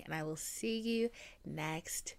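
slow soft whisper. Real, not acted. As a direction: whisper a gentle goodbye. Soft sign-off.

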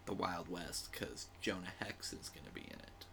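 whispered aside; whispering. Real, not acted. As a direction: breathe it without voice. Unvoiced aside.

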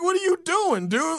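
mock disbelief at a dumb remark; baffled outrage. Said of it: "I'm like".

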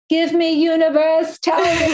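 a mock cry to the universe for a sign, called out high; calling to the universe. Speaking about openness to big changes, calls out to the universe in a high, slow plea. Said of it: "now I feel like I'm like".

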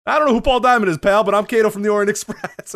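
high, loud, indignant wrestler voice; imitating the limo driver. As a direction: play the driver insisting on his gimmick. Limo driver insists he is Kato.

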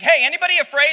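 loud, high call to the whole army; calling out to the troops. Acted. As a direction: shout the question across the camp.

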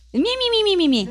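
high squeaky mock creature chirp; squeaky unicorn voice. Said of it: "Hablo su idioma".

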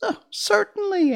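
very high, loud mouse queen; high-pitched little squeaker; squeaky little voice. A narrator's reading. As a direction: answer in a tiny royal squeak. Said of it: "in her squeaky little voice".

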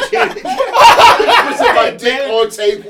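loud, high, slow through laughter; cracking up at the absurdity; laughing hard. Real, not acted. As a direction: barely get it out for laughing.

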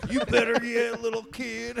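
put-on high, loud country-accent dad; hammed-up country accent, teasing the kid; mock country accent dad checking on the kid. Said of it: "giving your dad a country accent".

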